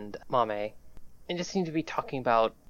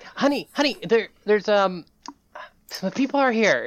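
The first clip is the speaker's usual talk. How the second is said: calling out; 8 semitones higher, 5 dB louder than usual. Acted. Wife calling to her wife.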